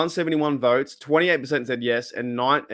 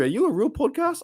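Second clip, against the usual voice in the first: amused surprise; 11 semitones higher than usual. Real, not acted. Reading a chat name.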